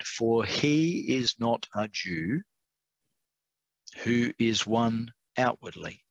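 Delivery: slow, deliberate scripture reading with pauses; measured, careful reading at a slow pace. Real, not acted. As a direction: read it out slowly, spacing the phrases. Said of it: "I have to read this slowly".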